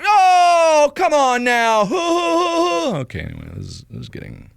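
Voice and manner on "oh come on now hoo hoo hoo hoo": high, loud, drawn-out falsetto protest; hammed-up sobbing meltdown; insane mock wailing. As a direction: plead and blubber, totally over the top. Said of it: "And I'm like".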